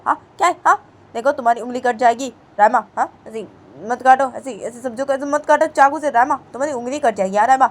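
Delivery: loud alarmed scolding; urgent warning. Acted. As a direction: shout a worried warning. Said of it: "तो बानी बोलती है".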